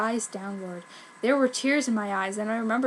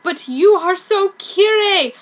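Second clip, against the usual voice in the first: sexy; 9 semitones higher, 9 dB louder, slower than usual. Acted. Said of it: "he said sexily".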